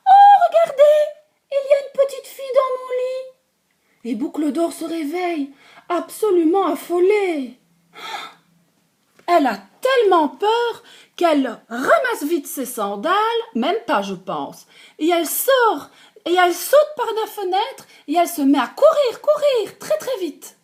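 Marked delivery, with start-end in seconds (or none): 0.1-3.2 very high, loud squeaky cub, quick; little bear cry. Acted. Baby bear crying out about the girl in his bed. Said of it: "crie de sa petite voix". 5.9-20.6 higher, louder, faster building panic; excited. A narrator's reading. Narrator telling Goldilocks' panicked escape, building excitement.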